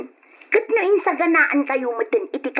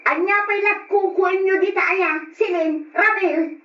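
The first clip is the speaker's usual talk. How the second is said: a raised, scolding call into the room; scolding auntie; 7 semitones higher than usual. Acted. Auntie scolding Silin and Ramil for staying up, raised voice. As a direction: snap at the kids for still being up.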